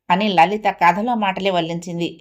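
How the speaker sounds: ordinary delivery, nothing marked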